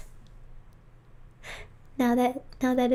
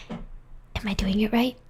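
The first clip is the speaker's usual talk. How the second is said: whispering; slower than usual. Real, not acted. Whispering ASMR stream.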